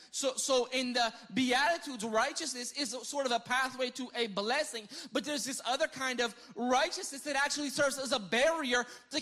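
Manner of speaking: fiery preacher; preaching. Real, not acted. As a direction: preach it hard to the room. Preaching at full force.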